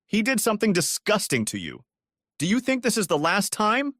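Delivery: women arguing, a high mild shout. A narrator's reading. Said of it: "Rosa shouted".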